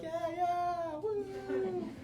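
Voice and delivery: high, soft sing-song brush-off; dismissive sing-song. Retold or imitated. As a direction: humour him with a lilting yeah yeah. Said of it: "I'm like".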